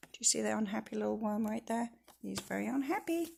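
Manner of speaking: fast hushed aside; whisper. Acted. Reader whispers to listeners about the worm. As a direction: let the children in on a secret. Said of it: "whisper aside to children".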